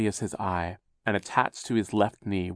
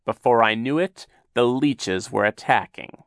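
higher, louder, smarmy sea captain; oily villain voice; 4 semitones higher, 6 dB louder than usual. Acted. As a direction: spin a fake tale sweetly. Captain Sham tells his story.

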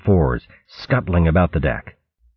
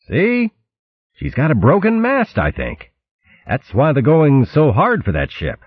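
put-on much higher, eager young mouse; Dulum's voice; 10 semitones higher than usual. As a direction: point it out brightly, pitched well up. Dulum points out broken mast.

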